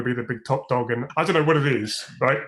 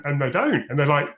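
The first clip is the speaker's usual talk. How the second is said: ordinary talk, nothing marked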